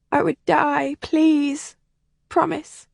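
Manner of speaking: put-on a sheltered girl close to tears; upset young lady. Helen confides in Kathleen, high and distressed.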